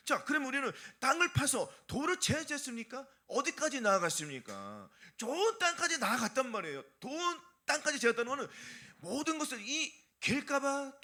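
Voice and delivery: ordinary talk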